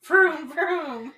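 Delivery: high, loud cartoon vroom; playful mouth-made engine revs; imitated car engine. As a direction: voice a toy car revving, amused. Imitating a foley artist making car sounds.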